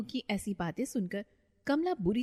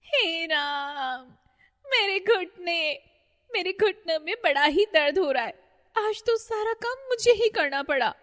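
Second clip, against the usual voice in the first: groaning in pain; 10 semitones higher, 6 dB louder than usual. Acted. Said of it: "दर्द से कराह रही थी".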